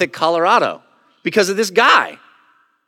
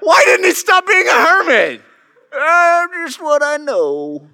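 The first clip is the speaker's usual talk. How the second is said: put-on old hermit voice; 11 semitones higher, 4 dB louder, slower than usual. Continues hermit voice.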